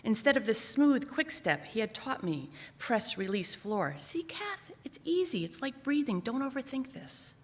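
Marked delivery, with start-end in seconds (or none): 4.0-7.3 coaxing. Retold or imitated. Dad coaching calmly.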